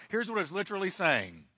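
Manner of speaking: usual speaking voice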